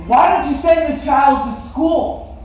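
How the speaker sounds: high, loud, slow demand; bold confronting question. Acted. Boy asking a cobbler about his child, loudly challenging. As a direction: put the question boldly, word by word.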